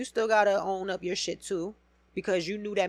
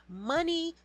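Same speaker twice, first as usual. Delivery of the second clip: normal talking voice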